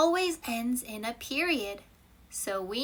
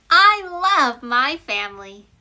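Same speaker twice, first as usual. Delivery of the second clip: loud joyful declaration; heartfelt exclamation; 9 dB louder than usual. Shown as a demonstration. Teacher giving an exclamatory example, loud and happy. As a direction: proclaim it with feeling. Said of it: "Exclamation mark".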